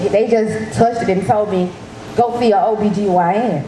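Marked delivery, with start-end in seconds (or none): none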